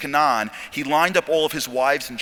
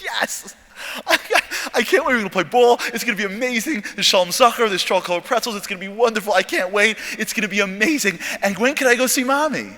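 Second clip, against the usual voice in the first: put-on high, louder, gushing child voice; excited little boy; 7 semitones higher, 4 dB louder than usual. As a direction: bubble over like a ten-year-old who can't wait. Boy thrilled about baby brother.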